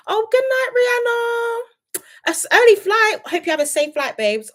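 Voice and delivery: high, drawn-out good night; high-pitched, stretched-out farewell; affectionate send-off. Real, not acted. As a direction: sing out a goodbye to a friend. Calling goodbye to a viewer.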